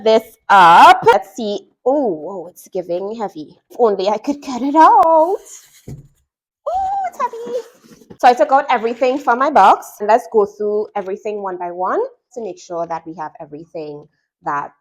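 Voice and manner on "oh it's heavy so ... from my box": ordinary delivery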